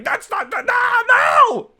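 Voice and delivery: mock outraged shriek, very high and loud; outraged fan. Tolkien fan in the chat objecting, outraged shriek. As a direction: scream it like an apoplectic nerd.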